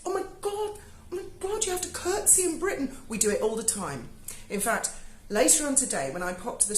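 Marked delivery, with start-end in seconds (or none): 0.0-3.0 high, gushing mock outrage; mock shocked American. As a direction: send up a horrified viewer, pitched up high.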